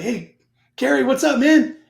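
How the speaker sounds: loud, high-pitched hail to a buddy; hearty greeting. Retold or imitated. Calling up an old deployment buddy, hearty greeting. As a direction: belt out a hello to an old friend.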